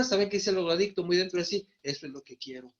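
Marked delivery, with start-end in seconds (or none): 1.8-2.7 much softer, lower, hushed inner reply. Retold or imitated. The addict answering inside himself, hushed and low.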